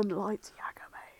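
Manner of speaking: hushed whispered intro. Acted. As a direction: whisper the name like a secret. Voicing Light in a story.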